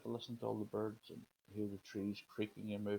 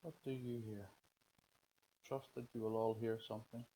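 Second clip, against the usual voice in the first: guided meditation. Acted. Leads a breathing meditation.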